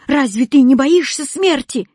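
high, loud alarmed question; yelling. A narrator's reading. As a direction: cry out the question. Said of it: "завопил Кузя". (испуганно вопить)

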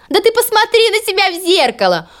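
sneering high voice; mocking sister. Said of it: "расхохотались сёстры".

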